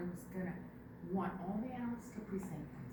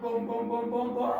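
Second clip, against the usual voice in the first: drum booms; 9 dB louder than usual. A narrator's reading. Drums of grandfather coming.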